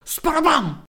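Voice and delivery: quick splash sound effect; water splash. A narrator's reading. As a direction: let the bucket of water slam down. Narrated water dumping down.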